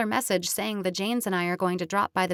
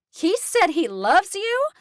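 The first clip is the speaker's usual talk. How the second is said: loud, much higher, slow excited squeal; near-scream of delight; 12 semitones higher, 8 dB louder, slower than usual. A narrator's reading. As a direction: squeal with disbelieving joy, loud and high. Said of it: "Lou almost screams".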